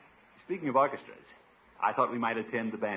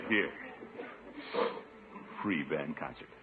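usual speaking voice

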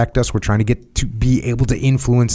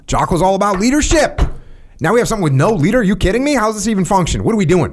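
mock asker outrage; 7 semitones higher than usual. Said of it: "going hard on my boy".

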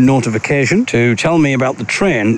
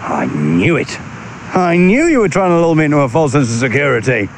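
usual speaking voice

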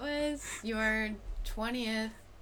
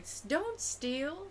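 scolding clerk voice; 7 semitones higher, 4 dB quieter, much slower than usual. Retold or imitated. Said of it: "And they're like".

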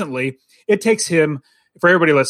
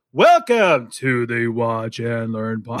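lower, slow monotone with no inflection; flat imitation of dad; 6 semitones lower, much slower than usual. Son mocking dad's flat intro, slow and low. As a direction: mimic a dad reading flatly. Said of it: "no, he'd go".

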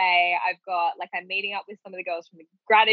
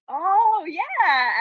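sheepish oh yeah; 12 semitones higher than usual. Retold or imitated. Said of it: "And he's like".

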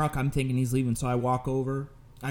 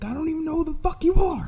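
very high, fast mock yell; strained high protest, imitating the cashier; imitated yelling; 3 dB louder, faster than usual. Cashier yells at a stranger attacking him, high frantic protest. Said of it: "the cashier's yelling".

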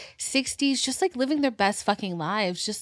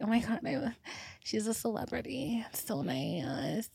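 starstruck whisper; 10 dB quieter than usual. Retold or imitated. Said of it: "I was like".

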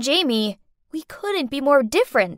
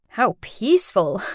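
plain reading voice

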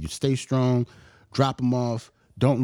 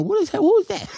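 mock wife, pitched way up; high, lightly imitated confused wife. Quoting wife who didn't know.

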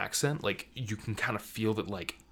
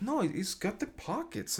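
higher-pitched, lightly imitated pockets enthusiast; excited character impression; 11 semitones higher, 4 dB quieter than usual. Film fan imitating a character defending her vest, lightly excited impression. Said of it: "the way she's just like".

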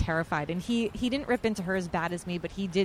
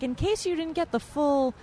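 raised pitch, building louder to the end; mock condescending priest; 5 semitones higher, 4 dB louder than usual. Priest re-explaining both readings to wedding guests, mock condescension. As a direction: spell it out pointedly, imitating a preachy officiant.

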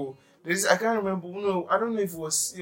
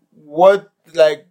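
shocked disbelief; 12 dB louder than usual. Retold or imitated. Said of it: "And I'm like".

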